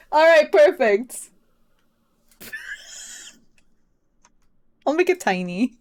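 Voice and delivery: ordinary talk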